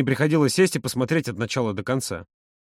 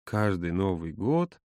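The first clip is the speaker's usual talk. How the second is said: low, soft, slow annoyed drawl; vexed drawl; 6 semitones lower, 7 dB quieter, much slower than usual. A narrator's reading. Володя sees the film's cover, drawling with annoyance. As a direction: drag it out in weary annoyance. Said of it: "досадливо протянул".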